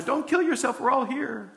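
high, urgent plea to stop him; calling out to the jailer. Acted. High urgent call. Jailers stopped by shouting prisoners.

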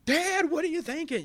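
high, loud incredulous cry; high and loud, disbelieving; shocked exclamation. Retold or imitated. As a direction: blurt it out amazed. Imagined son's shocked call.

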